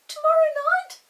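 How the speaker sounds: high, quick little earl voice. Acted. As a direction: pipe up like a tiny earl asking a favour. Earls answer Chinky.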